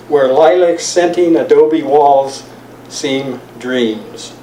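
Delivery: measured, deliberate poem reading; poetry reading. Acted. Reads the poem aloud.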